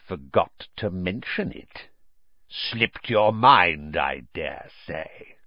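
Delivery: slow, mock-understanding sneer; menacing sarcasm. A narrator's reading. Uncle cornering Harry like a bulldog, menacing sarcasm. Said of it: "a mad gleam dancing in his eyes".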